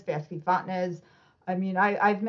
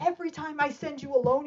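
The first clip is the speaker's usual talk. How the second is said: higher, imitating an exasperated coach; pouting complaint; 7 semitones higher than usual. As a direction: retell a sulky scolding. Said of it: "and he's pouting".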